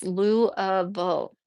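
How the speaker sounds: Lou-uh-ville slowly as LOO-uh-vul; local-style Lou-uh-ville, no ee sound; correcting pronunciation. Shown as a demonstration. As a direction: stretch Lou-uh-ville into three syllables, uh in the middle. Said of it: "It's not Louisville".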